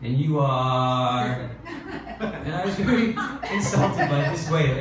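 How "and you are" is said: slow, sing-song And you are; receptionist's sing-song And you are; drawn-out prompt. Retold or imitated. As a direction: stretch And you are, leaving it hanging. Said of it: "she said".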